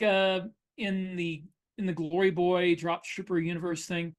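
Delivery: ordinary delivery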